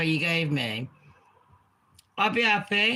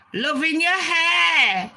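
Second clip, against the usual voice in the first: much higher, louder, slow fangirl voice; mock fan comment; 11 semitones higher, 6 dB louder, slower than usual. Reading a fan comment aloud, mock fangirl voice. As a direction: gush it like a fan. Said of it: "I'm gonna read out some of them".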